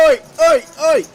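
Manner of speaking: real celebratory oi-oi shout. Real, not acted. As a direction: shout it like a football fan. Celebrating goal.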